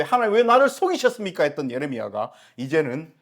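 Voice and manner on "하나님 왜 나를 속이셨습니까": high, loud protest to God; louder pleading protest; anguished cry. Acted. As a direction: cry out in betrayal. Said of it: "칠 절 시작하면서".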